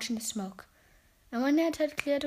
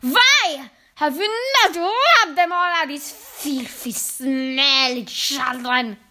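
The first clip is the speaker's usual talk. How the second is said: screaming witch; 3 semitones higher, 7 dB louder, slower than usual. A narrator's reading. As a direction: stretch out every word in witchy fury. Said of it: "she screamed".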